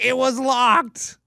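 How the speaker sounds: high, drawn-out mock-tearful protest; imitated crying child. Five-year-old answering dad about the car door, mock crying. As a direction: wail it slowly like an upset kid. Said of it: "crying. He's like".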